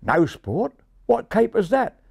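high Australian pigeon voice; high Australian twang; Australian accent. Acted. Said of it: "an Australian colleague answered".

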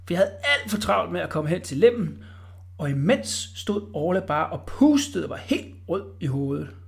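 plain reading voice

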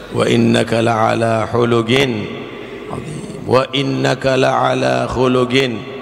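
slow chanted verse; Quran recitation. Acted. Preacher reciting a verse about the Prophet, slow and chanted. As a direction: stretch each word in a recitation tune.

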